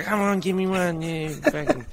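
high-pitched mock junkie pleading; begging junkie. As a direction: wheedle like a junkie after a smoke. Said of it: "So let's say junkies".

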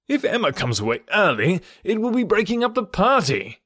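animated objection climbing high at the end; protesting cry. A narrator's reading. Mister Weston objecting to breaking up the party, animated protest. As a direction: object brightly, pitch leaping up. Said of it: "cried Mister Weston".

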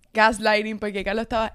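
ordinary talk, nothing marked

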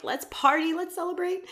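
high, giddy mock celebration; a woman suddenly ready to party; party call. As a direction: chirp it like she's thrilled. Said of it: "and was like".

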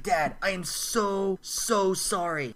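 slow, drawn-out acted apology; earnest retold apology, dragged out; heartfelt apology. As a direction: stretch out each "so". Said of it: "Kevin goes on this rant".

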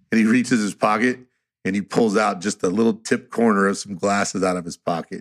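ordinary delivery

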